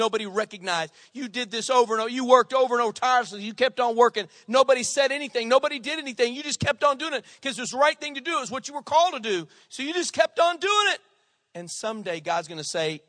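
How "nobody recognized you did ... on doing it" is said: high, loud, driving preacher cadence; full-force preaching. Real, not acted. Pastor urging the faithful, preaching at full force. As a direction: preach it out to the congregation.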